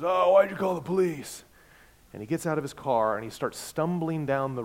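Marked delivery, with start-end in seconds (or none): 0.0-1.4 put-on high, loud, aggrieved drunk voice; drunk driver. Drunk driver complaining that the pastor called the police, slurred. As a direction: sound like a drunk who feels betrayed. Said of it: "He is completely drunk".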